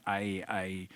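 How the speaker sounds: small, low, soft stammer; nervous. Retold or imitated. Co-star at the premiere unable to talk, nervous murmur. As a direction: imitate someone too nervous to speak. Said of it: "so nervous".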